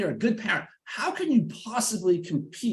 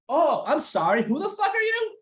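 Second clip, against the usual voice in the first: sarcastic fake apology rising high into a sneer; high, snide put-down; mock-polite retort; 11 semitones higher than usual. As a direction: pretend sorry, then turn it into an insult. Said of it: "exactly what I said".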